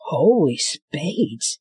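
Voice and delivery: slow, strained under a load; man straining under Wolf's weight; breathless mutter. Acted. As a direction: mutter it while heaving weight. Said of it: "he muttered".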